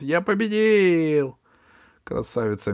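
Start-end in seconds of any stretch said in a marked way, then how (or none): 0.0-1.4 high, slow gloating cry; triumphant cat. Acted. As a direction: crow over the victory. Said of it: "закричал кот".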